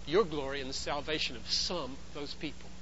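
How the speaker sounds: very soft, hushed prayer; devoted prayer. Acted. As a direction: offer it quietly to the Father. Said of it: "He looks up to the Father and he says".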